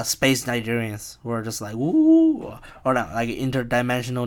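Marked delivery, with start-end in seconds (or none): 1.8-2.6 high-pitched drawn-out ooh; put-on wooing noise, way up high. Imitating interdimensional visitors, a high hammed-up ooh. Imitates spooky space Nigerians.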